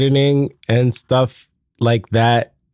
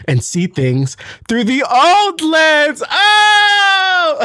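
high, drawn-out mock drama rising to a cry; hammed-up punchline; 4 dB louder, much slower than usual. Podcaster milking his glasses pun for co-host, hammed-up cry. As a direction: stretch it out and finish on a loud mock wail.

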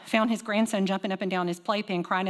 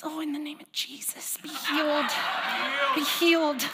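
put-on whispered, then rising child's prayer; child praying; 6 semitones higher than usual. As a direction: toddler praying over her pinched leg. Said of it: "And she goes".

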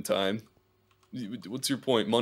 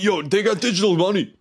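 hyped Discord guy; 9 semitones higher, 6 dB louder than usual. Retold or imitated. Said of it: "you're like".

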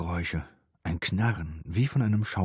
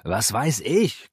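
slow, louder irritated comeback; raised, quarrelsome retort; nagging retort; 5 semitones higher, 5 dB louder, slower than usual. A narrator's reading. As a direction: snipe back crossly, stretching the words. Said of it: "keifte er zurück".

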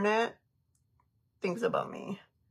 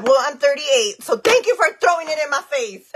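mock offended; 6 semitones higher, 9 dB louder than usual. Mock offense at age compliment.

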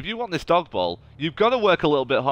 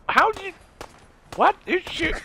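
startled. Real, not acted. Shot from nowhere.

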